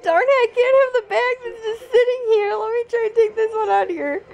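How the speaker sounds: high, fast whiny dog voice through laughter; high laughing pup voice; voicing the dog. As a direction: speak for the frustrated puppy. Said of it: "She's like".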